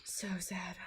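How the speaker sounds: hushed, sad whisper. Real, not acted. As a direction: breathe it out sadly. Sad scene, friend's death.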